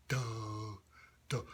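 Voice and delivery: soft dopey throat sound; slow-witted character. Shown as a demonstration. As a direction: place it way back in the throat. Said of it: "coming way in the back of your throat".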